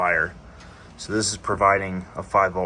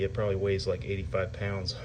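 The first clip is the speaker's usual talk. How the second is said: normal talking voice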